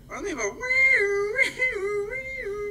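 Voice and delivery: high, loud, imitated police siren wail; siren noise. Shown as a demonstration. Co-host mimicking the siren the live feed lacks, high and loud. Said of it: "why don't they have a little sound to go with it".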